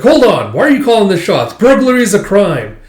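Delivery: high, loud, righteous protest; indignant objection. Retold or imitated. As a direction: call out the crime. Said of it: "It's like".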